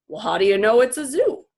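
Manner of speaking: lightly voiced skeptical father; doubting dad. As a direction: challenge her quickly. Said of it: "George asked".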